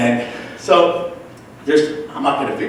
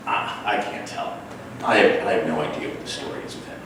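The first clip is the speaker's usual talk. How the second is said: hushed aside; 5 semitones lower, 6 dB quieter, faster than usual. Retold or imitated. Confiding to friend Lisa.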